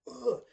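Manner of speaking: a loud, high, fed-up groan; disgusted ugh. Retold or imitated. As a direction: let out an exasperated ugh. Disgusted groan at awkward scene.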